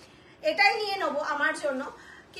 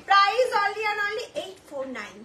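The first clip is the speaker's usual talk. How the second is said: each word of the price spelled out very slowly; slow right down so nobody misses the price; much slower than usual. Shown as a demonstration. Seller to live viewers announcing the price, very slowly. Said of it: "একদম আস্তে আস্তে বলছি".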